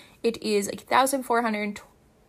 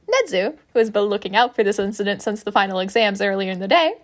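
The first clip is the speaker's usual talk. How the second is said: ordinary delivery, nothing marked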